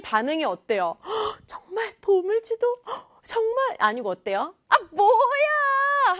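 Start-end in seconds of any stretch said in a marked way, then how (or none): 1.1-3.8 high gasping mock surprise. Sister mocking the treasure map, gasping high. 4.7-6.2 high, loud, slow scoffing jeer; mocking scoff. Sister laughing at her brother, loud high scoff. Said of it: "막 비웃음을 샀죠".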